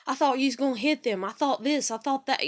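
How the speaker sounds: husband explaining his fear; worked-up husband. Retold or imitated. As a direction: mimic his rattled worry. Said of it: "he's like".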